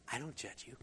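soft, tender reassurance to the woman; quietly, like forgiving her; gentle Jesus. Acted. Jesus gently telling the woman he does not judge her, soft and tender. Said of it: "And he said".

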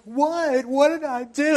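high, bewildered what did I do; high startled protest; baffled what. Retold or imitated. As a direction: act out total confusion. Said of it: "And I'm like".

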